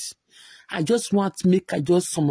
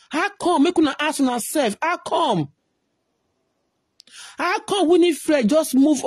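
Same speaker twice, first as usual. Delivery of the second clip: raised, indignant ranting; heated rant; 5 semitones higher than usual. Real, not acted. Vlogger scolding Winifred for dumping his brother, heated rant. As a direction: scold her, voice up high.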